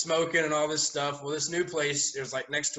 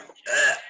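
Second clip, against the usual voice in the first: disgusted groan; 6 dB louder than usual. Retold or imitated. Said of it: "I'm like".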